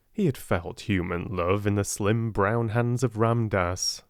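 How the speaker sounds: ordinary delivery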